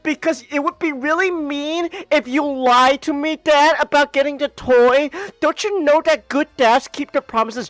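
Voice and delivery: put-on a boy guilt-tripping his father; kid voice. Kid guilt-trips dad.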